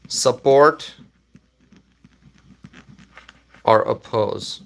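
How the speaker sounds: choices spaced out by long pauses; word-by-word dictation. Real, not acted. Streamer entering poll options, halting dictation. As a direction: say each option separately, as if typing. Typing out the poll's answer options.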